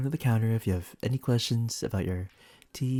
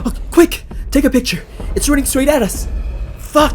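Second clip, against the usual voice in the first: much higher, loud terrified yells; panicked screaming; 11 dB louder than usual. Acted. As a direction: scream as the monster charges. Monster charging at them.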